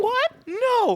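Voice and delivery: very high squeaky protest; hammed-up high-pitched disbelief; mock disbelief. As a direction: imitate a victim's high-pitched panic. Voicing the victim told she's wrong.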